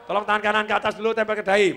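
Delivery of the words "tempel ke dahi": usual speaking voice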